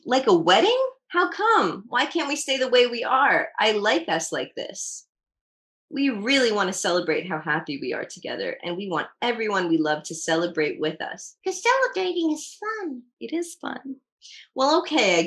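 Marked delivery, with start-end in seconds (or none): none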